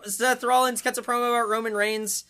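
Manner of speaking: normal talking voice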